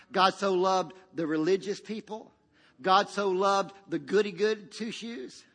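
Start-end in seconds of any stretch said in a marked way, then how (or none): none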